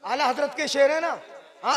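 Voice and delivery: ordinary talk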